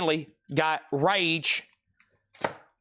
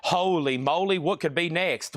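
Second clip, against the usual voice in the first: ordinary talk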